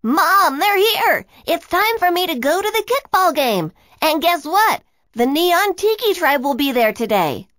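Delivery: put-on high, loud, excited boy's shout. Boy shouting to his mom that friends arrived, excited. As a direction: call out to Mom with glee. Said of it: "Isaac jumped up and shouted".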